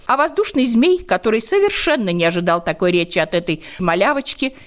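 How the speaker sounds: plain reading voice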